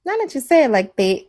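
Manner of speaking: high, laughing give-in to her husband; laughing reply. Retold or imitated. Wife conceding to husband, breaking into laughter. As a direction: crack up while conceding the point. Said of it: "And I'm like".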